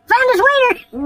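very high, slow mock cop announcement. Cop at the dumpster, announcing in a high mock voice. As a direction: hold it up and announce it. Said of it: "and says".

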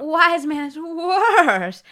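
hammed-up desperate villager; frantic villager. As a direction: plead with the wise man, panicked. Voicing frantic man in the story.

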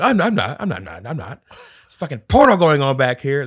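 loud, high protesting yell; mock yelled denial. Retelling a movie scene, character yelling a denial in the theater. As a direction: yell it like you're caught out and indignant.

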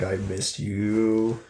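low, soft, drawn-out affection; tender reunion. Acted. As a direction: say it slowly and quietly into a hug. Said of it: "He's like".

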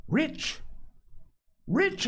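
high, insistent exclamation; hotly. A narrator's reading. Castaway repeating his claim to a doubting boy, hotly. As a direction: leap up in pitch, insisting on it. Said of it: "he repeated the statement hotly".

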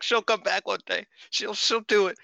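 high-pitched, imitating own hopeful self; wishful insistence. Host mocking their own copium, high wishful voice. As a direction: say it far above your normal pitch, mock wishful.

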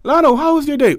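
suspiciously sweet sibling hello; sister's sweet voice. Retold or imitated.

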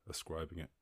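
usual speaking voice

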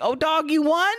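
high-pitched, delighted surprise, imitated; high, hammed-up congratulating voice; mock excited congratulations. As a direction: cheer him on in comic amazement. Acts out R-Truth celebrating with Wesley.

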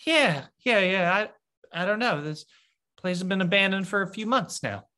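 put-on high, jittery young guard; nervous guard voice. NPC guard nervously answering.

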